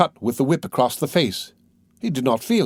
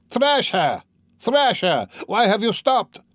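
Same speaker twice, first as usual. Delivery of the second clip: jeering shouts; 9 semitones higher, slower than usual. A narrator's reading. Crowd urging Mikolka to keep beating, jeering shouts. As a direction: egg him on, bloodthirsty. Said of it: "shouted voices in the crowd".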